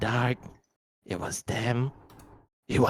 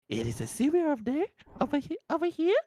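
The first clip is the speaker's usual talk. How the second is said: sly lure; 12 semitones higher, faster than usual. Acted. Creature luring the child.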